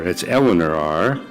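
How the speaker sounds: ordinary delivery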